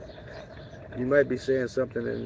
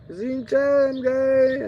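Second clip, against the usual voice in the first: much higher and louder greeting voice; cheery greeting; 12 semitones higher, 7 dB louder, much slower than usual. Pretend. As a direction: call out the hello up high. Greeting old women passing by.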